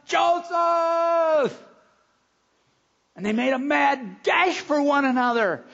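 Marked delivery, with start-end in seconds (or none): 0.1-1.6 loud high call of "Joseph!"; shout. Retold or imitated. Father spotting his lost son across the crowd, shouting. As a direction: call out across the crowd, much higher and louder. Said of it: "And Chris shouted".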